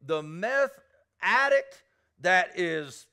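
fervent Southern preacher calling out; preaching at full force. Acted. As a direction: drive it home like a revival preacher.

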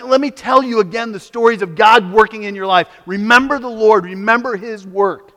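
fast, loud, high preaching; preaching at full force. Acted. Preacher retelling the Exodus to the congregation, at full force. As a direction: sweep them along in the story.

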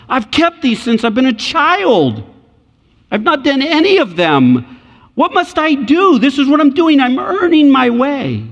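put-on a rich young man bragging to Jesus; boastful rich man. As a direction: crow like a man sure he's earned it. Rich man hoping to earn heaven.

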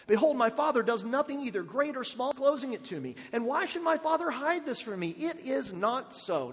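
high, emphatic scripture reading as Jonathan. Acted. Jonathan reassuring David his father means no harm, high emphatic reading. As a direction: declare it with naive confidence. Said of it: "Verse two".

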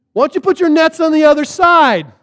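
high, loud, helpful holler; calling out. Retold or imitated. Stranger on shore advises the fishermen, calling out. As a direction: project advice over the water.

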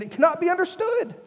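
high-pitched exasperated insistence; frustrated. Acted. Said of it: "Can you feel his frustration?".

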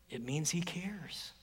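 hushed, sincere; tender whisper. Real, not acted. Preacher whispering to the congregation that Jesus cares. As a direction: whisper the comfort.

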